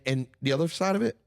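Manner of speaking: ordinary delivery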